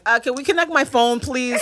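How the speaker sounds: mother mimics her daughter asking for her phone; imitating a five-year-old. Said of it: "She's like".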